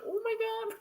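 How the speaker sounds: very high, squeezed-up exclamation; overcome reaction. Retold or imitated. Reacting to the lost little girl, overcome high voice. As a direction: retell being overcome, pitch shooting up. Retells own reaction to child spirit.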